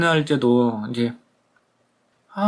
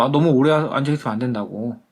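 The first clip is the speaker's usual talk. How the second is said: ordinary talk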